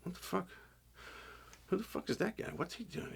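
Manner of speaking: quiet, mock-baffled mutter of onlookers; hushed puzzled whisper. Confused crew members whispering about the masked figure, hushed. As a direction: breathe the question under your breath, spooked. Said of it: "people are looking like".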